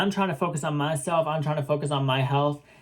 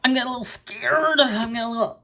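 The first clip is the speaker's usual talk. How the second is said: high-pitched, hammed-up worry; acted alarm; 8 semitones higher, faster than usual. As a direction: raise the pitch sharply, playing up being scared. Acts out growing alarm at friend.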